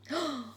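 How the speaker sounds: a hammed-up gasp of shock; stagey storyteller gasp; dramatic gasp. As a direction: gasp as if caught out. Reveal of the burglar lady.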